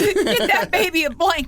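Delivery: high, laughing mock concern; joking pity. Joking about horses in snow, high laughing fuss.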